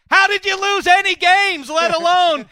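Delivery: high, loud, exasperated demand; mock outraged shout. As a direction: blow up in hammed-up disbelief. Mock outburst grilling guest over team.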